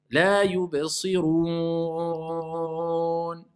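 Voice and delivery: slow chant, yubsirun hummed through the nose; sustained nasalized yubsirun; nasal mistake. Shown as a demonstration. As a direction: drag the end of yubsirun as a nasal "ooon". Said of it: "on dit pas on avec le nez".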